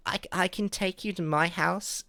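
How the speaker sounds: put-on high, hesitant child voice; little kid voice. Little kid offering police a way home, high child voice. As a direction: imitate a small kid timidly offering. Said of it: "as a little kid".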